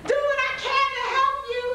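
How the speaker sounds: high, fast mock sweetness; hammed-up fake devotion; mock sweet talk. Mocking shallow love for Jesus.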